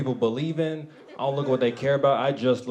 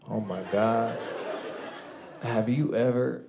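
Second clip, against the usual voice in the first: normal talking voice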